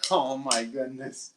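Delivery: drawn-out deflated sigh of words; dismayed groan. Retold or imitated. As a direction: sag as if your team just lost. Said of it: "they lose and you're like".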